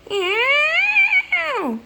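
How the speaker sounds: high, loud wailing meow; long squealed cat cry. Acted. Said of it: "where this cat is".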